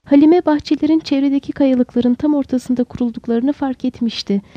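ordinary delivery, nothing marked

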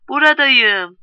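loud, high drawn-out call; shouting. A narrator's reading. Neighbour girl answering Filiz from the street, shouting. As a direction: shout it out as a reply. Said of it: "Sokaktaki kız bağırdı".

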